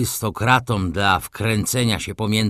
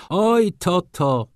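ironic; 8 semitones higher, slower than usual. A narrator's reading. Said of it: "patrząc ironicznie na Rzeckiego".

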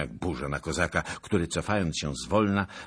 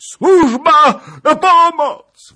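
very high, drawn-out scream for help; desperate cry; 20 dB louder, much slower than usual. A narrator's reading. Princess calling the servants for help, desperate scream. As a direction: scream it out in despair. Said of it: "wołała rozpaczliwie kniagini".

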